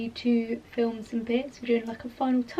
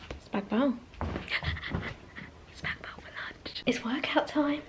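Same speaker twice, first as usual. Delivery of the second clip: whispering; 10 dB quieter than usual. Real, not acted. Whispered vlog asides.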